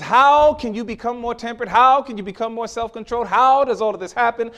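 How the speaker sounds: loud, high, ringing questions; preaching God's question. Acted. Preacher voicing God's questions to the congregation, loud and ringing. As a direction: proclaim it as God's challenge. God voiced, questioning loudly.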